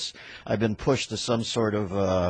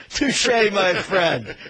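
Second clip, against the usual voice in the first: mock grand touche; 10 semitones higher, 8 dB louder, much slower than usual. Mock gracious concession.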